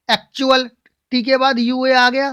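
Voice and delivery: usual speaking voice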